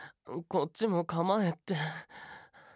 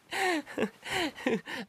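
high falling giggle fit; ticklish laugh; 11 semitones higher than usual. Acted. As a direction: giggle helplessly. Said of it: "くすぐったい".

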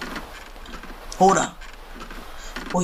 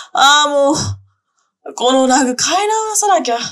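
louder, higher, fed-up groan about the rug; annoyed mistress, loud and exasperated; exasperated outburst; 4 semitones higher, 5 dB louder than usual. Acted. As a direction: vent your irritation out loud.

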